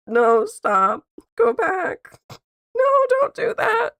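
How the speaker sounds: high panicked yelling, rising; panicked shout. Real, not acted. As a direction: shout no at the screen. Panicked at a wrong edit.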